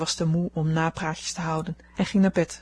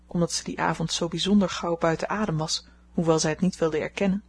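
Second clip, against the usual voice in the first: ordinary delivery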